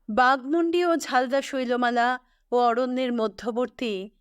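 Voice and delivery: plain reading voice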